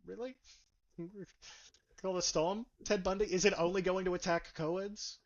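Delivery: incredulous echoing question, pitch lifted. Retold or imitated. Reviewer reacting to a silly storm name, incredulous. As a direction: question it in mock disbelief, rising on the name. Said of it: "And I'm like".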